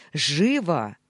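loud barked order. A narrator's reading. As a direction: bark it at him. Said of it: "рявкнул я".

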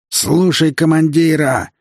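high, drawn-out battle orders; rally the platoon over the din; commander's roar. A narrator's reading. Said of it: "командирский рык". (командирский рык)

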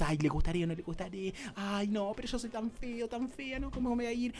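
mock-timid self-doubt, voice dropping away; timid person. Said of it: "vas así como más tímido".

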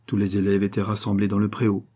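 ordinary delivery

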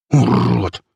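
spiteful growl with a rolled r; vicious, rolling growl. A narrator's reading. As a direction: snarl the insult. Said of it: "Посмотрел злобно на слона и прорычал".

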